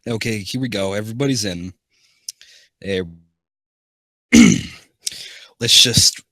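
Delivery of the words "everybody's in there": ordinary delivery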